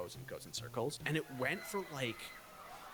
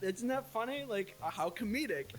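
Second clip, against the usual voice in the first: amused dad; 8 semitones higher than usual. Retold or imitated. Dad amused at upset child.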